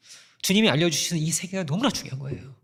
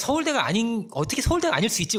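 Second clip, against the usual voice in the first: mock scornful parent; 7 semitones higher, 4 dB louder than usual. Parents scorning son's school.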